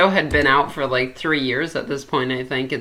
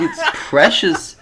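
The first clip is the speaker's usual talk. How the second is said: mock right-wing politician; 4 semitones higher, 5 dB louder than usual. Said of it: "that's what right-wing politicians are like".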